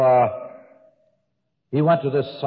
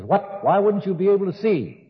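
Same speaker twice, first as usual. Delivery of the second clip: mock surprise; 8 semitones higher than usual. Psychiatrist's puzzled retort in joke.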